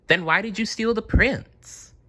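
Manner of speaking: put-on high, light girl's voice, pressing the dragon; a hint of a bold young girl; Jane challenging.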